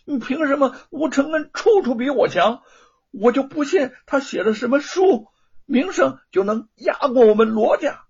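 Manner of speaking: high, loud jealous rant; resentful outburst. Acted. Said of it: "有些咽不下这口气儿".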